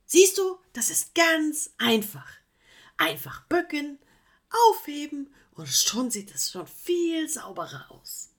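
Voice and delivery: high, loud insistent explaining; lecturing. Acted. Koala showing the sloth how easy tidying is, insistent. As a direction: explain it as if it's obvious.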